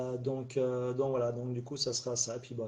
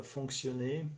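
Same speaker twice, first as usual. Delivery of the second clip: usual speaking voice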